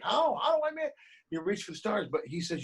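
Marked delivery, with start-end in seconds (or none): 0.0-0.9 mock baffled asking, pitched much higher; puzzled listeners. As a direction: play the lost hopeful pleading how. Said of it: "they're like".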